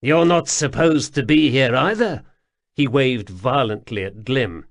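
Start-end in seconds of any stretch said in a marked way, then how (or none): 0.0-2.2 high, loud jittery stranger; pleading, flustered little man; nervous odd man voice. A narrator's reading. Wemreddle scolding.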